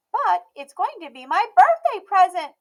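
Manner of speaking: put-on high, bright little girl voice, loud and proud; a stubborn little girl declaring herself; Emmy's child voice. A narrator's reading. Emmy speaking.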